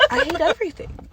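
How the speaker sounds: laughing out the words; bitter laugh while saying it. Real, not acted. Venting to viewers, laughing in disbelief.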